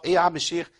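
ordinary talk

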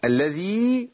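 tajweed recitation; recited madd. Shown as a demonstration. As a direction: hold the long ي of الذي. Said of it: "أدي ياء مادية".

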